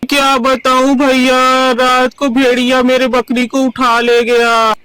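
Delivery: drawn-out crying lament; tearful wailing. Acted. Villager telling neighbour a wolf took his goat, wailing. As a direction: wail it through in one long sob. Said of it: "क्यों रो रहे हो".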